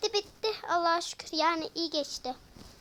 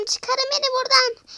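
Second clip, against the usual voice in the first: much higher, louder plea; desperate shout; 9 semitones higher, 10 dB louder than usual. Acted. Frozen doll begging to be taken out, shouting. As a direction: yell to be let out.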